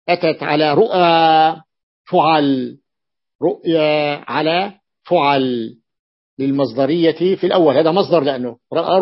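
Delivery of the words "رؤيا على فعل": ordinary delivery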